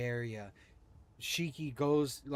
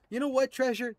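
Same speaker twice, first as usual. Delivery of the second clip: exasperated; 10 semitones higher, 4 dB louder, faster than usual. Acted. Rider complains to his horse.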